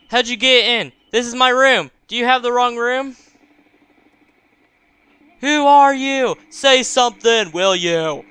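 loud, high, indignant questioning; alarmed demand. Acted. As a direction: demand answers from an intruder, loudly. Frightened mother confronts unrecognized visitor.

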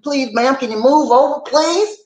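high, pleading little voice; polite plea. Retold or imitated. Commuter pleading with a stranger, high and polite.

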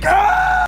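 high, loud scream of pain. Acted. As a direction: scream as it rips free. Scream as pulled.

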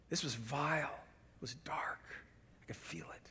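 low, very soft, sinking to a whisper; hushed gravity. Real, not acted. Preacher grieving over a sinful outburst, hushed. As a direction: let it fade down into a grave whisper.

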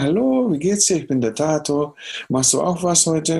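high-pitched, chirpy re-enacted hello; eager greeting. Introducing himself to the violinist at soundcheck, eager greeting. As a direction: greet a stranger keenly, pitched well up.

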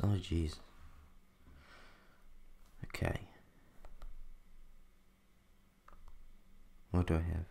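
quiet, hiccups breaking in; softly talking through hiccups. Real, not acted. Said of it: "just ignore my hiccups".

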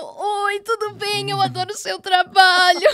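high mock-sobbing greeting; hammed-up weepy fangirl; in floods of tears. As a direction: gush to your idol through fake tears. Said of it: "já os prantos".

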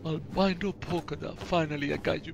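ordinary talk

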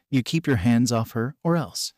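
ordinary delivery

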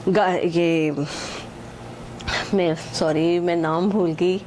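ordinary delivery

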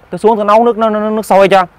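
a lightly voiced wife insisting on going down; insistent wife. Wife insists on boiling water.

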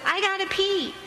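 a hint of a whiny five-year-old; a touch of a small child's plea; little girl. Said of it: "The five-year-old little girl says".

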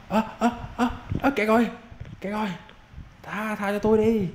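mock desperate plea; panicked pleading. Real, not acted. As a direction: beg for mercy when cornered. Gamer trapped, begging for mercy.

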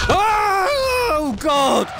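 terrified shout. Real, not acted. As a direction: yelp as the monster jumps out. Jump scare in horror game.

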